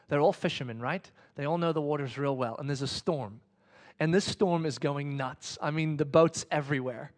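ordinary delivery

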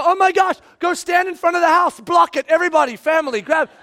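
high, urgent shouted orders; hammed-up alarm, rallying the family; mock panic. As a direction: shout frantically as if disaster is coming. Acting out storm panic.

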